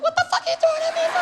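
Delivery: very high, fast shriek of panic; panicked outburst. Retold or imitated. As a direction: screech it in total disbelief. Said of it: "I was like".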